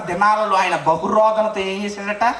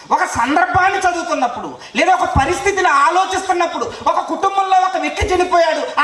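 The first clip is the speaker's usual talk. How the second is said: raised, higher-pitched pulpit delivery; forceful preaching; 7 semitones higher than usual. Real, not acted. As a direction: preach it out insistently to the congregation. (గొంతు పెంచి ఉద్వేగంగా బోధిస్తూ)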